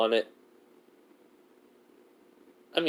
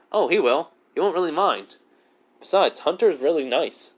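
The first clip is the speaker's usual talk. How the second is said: higher-pitched, lilting reassurance; acted reassurance; 6 semitones higher than usual. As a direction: reassure a friend in a raised pitch.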